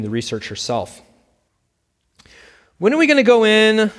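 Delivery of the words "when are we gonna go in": put-on higher, louder childish whining; a fidgety child wanting in now; impatient little girl. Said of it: "she heard her daughter say".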